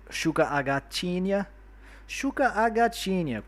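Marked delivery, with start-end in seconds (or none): 2.1-3.5 high, singsong Brazilian Portuguese accent. Shown as a demonstration. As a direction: say it much higher, like a Brazilian. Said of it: "como sotaque brasileiro".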